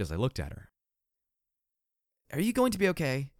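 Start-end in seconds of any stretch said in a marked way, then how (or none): 2.3-3.3 concerned. Acted. Concerned question voiced.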